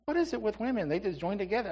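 high mock-female voice; hammed-up high lady voice; mock woman's voice. Mocking women in store.

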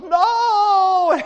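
high, loud mock sob-shout; retold sobbing no. Crying girl answers the stranger with a loud wailing no. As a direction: burst out with a tearful no. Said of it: "And she goes".

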